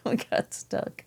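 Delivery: airy giggling whisper; laughing. Real, not acted. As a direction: laugh it out under breath. Laughing repeat of getting stuck.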